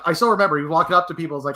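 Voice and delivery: usual speaking voice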